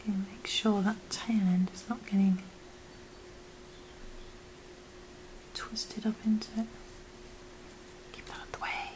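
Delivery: soft unvoiced whisper. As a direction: whisper it gently. Whispered craft tutorial.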